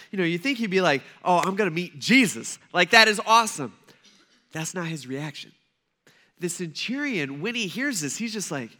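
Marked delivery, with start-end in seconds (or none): 1.2-3.7 mock thrilled gush about meeting Jesus. Centurion imagined meeting Jesus, playfully thrilled. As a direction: act starstruck, building louder.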